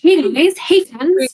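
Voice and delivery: high, perky mock American accent; plucky American accent. Player mimics a friend's character greeting, plucky American accent. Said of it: "plucky American accent like Ender does".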